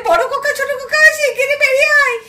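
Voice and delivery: high, urgent cry for help; frightened calling. Acted. Child calling the uncles as the fight turns violent. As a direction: call the uncles out in alarm.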